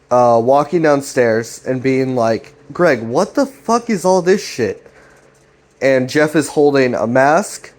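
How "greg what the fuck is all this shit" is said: exasperated confrontation. Retold or imitated. Jeff confronting Greg on the stairs, mock exasperation. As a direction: act out a bewildered, accusing brother.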